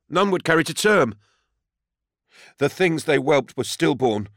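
high, pleading farmer; high-pitched distressed confession; desperate defendant. A narrator's reading. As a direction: plead your hardship in a high, urgent voice. Desperate defendant recounts his troubles.

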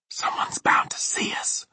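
Sophie's urgent whisper. Acted. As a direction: whisper a warning from hiding. Sophie hiding, fears being seen.

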